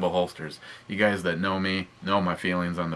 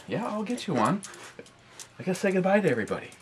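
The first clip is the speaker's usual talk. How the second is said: talking to a dog; 11 semitones higher, 3 dB quieter, much faster than usual. Real, not acted.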